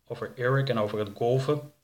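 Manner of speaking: ordinary delivery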